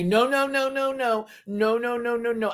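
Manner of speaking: high, rapid protesting nos; frantic no no no. Retold or imitated. Imitating Maddie backpedalling with high frantic nos. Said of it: "Then Maddie".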